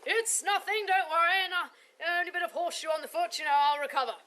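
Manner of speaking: high, loud, forced cheer; brave-faced wince. Acted. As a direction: pretend it doesn't hurt. Knight trodden by horse.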